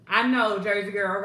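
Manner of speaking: loud, bursting "I know"; excited. Real, not acted. Hostess cheering a viewer after her workout, loud and excited. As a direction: cheer her on at full volume.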